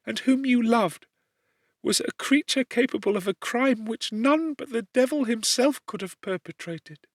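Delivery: put-on lightly tearful, resigned young prisoner; condemned servant girl. A narrator's reading. Justine continues, weeping.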